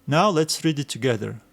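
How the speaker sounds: ordinary talk, nothing marked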